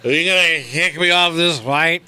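put-on slurred, high drunk guy. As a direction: play a belligerent drunk passenger. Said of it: "when I do the drunk voice".

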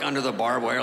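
ordinary delivery

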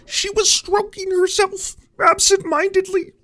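measured Kermit-like reading with halting pauses; solemn audiobook parody, pitch creeping upward; Jordan Peterson. Acted. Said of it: "Who wants to read it in his voice?".

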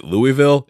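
"Louisville" said as LOO-ih-vil; one variant. Shown as a demonstration. Host listing the many local ways to say the city. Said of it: "seven thousand different ways to say it".

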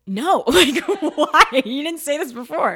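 high, shouted comic disbelief; outraged protest. Retold or imitated. Actor surprised by an Irish accent request, outraged protest. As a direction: blurt it out loud, acting baffled and put upon. Imagined outraged reply at callback.

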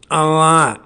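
put-on a light take on the older Chappelle voice. Shown as a demonstration. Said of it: "I just gave you both".

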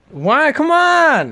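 high playful plea; pleading. Real, not acted. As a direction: come on, beg her. Pleading friend to say it.

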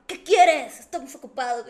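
loud, sharp retold demand; irritated father. Dad interrupted while scolding daughter, loudly irritated. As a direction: shout it like an interrupted angry dad. Papá enojado ante la interrupción.

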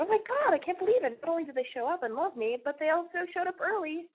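high, gushing mock delight; squealing excited inner voice; excited disbelief. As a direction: replay the thrilled thought. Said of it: "So I thought".

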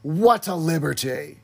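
slow, drawn-out comic indignation; lightly put-on comic character voice, stretching the words; imitated catchphrase. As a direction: play it as a mock-affronted catchphrase. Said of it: "who does that remind you of".

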